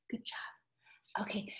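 a soft unvoiced whisper. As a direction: whisper it like a secret to the class.

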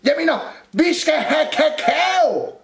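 high, loud flustered holler; confused shout. A narrator's reading. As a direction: shout it out, correcting yourself. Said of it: "råbte Rumle forvirret". (råber forvirret)

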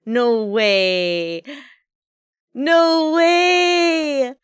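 loud, long "no way" of disbelief; alarmed yell. Real, not acted. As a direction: cry out in real dismay, stretching it. Stream seems to be crashing.

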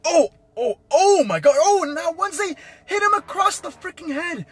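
high, loud burst of astonishment; shocked reaction. Real, not acted. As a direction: yell it like you just saw a huge hit. Live reaction to a big hit.

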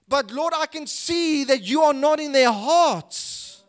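high, loud impassioned preaching voicing Jeremiah's complaint; fervent, driving pulpit delivery; preaching at full force. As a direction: cry out Jeremiah's lament to God from the pulpit.

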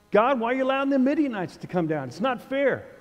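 mock a petulant complaint to God; whiny complaint. Gideon whining to God about the Midianites. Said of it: "Much like Gideon".

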